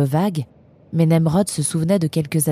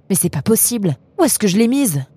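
flustered; 5 semitones higher than usual. Acted. Frantically searching her pockets.

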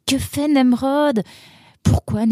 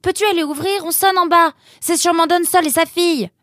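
high-pitched call to another room; 5 semitones higher than usual. Acted. Roxane asking Nemrod from the bathroom, calling out. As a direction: call out an urgent request.

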